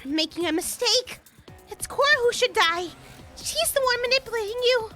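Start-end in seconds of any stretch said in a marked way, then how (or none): 1.7-4.9 high, loud pleading accusation; loud, desperate accusation of Cora. Acted. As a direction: turn them against her, loudly. Accused begs others, pointing at Cora.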